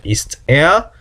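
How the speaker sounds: usual speaking voice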